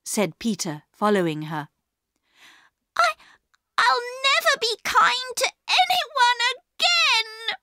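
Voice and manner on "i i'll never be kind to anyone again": put-on very high, tearful child's wail; high-pitched, on the edge of tears; starting to cry. A narrator's reading. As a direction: wail it like a hurt little girl. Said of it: "starting to cry".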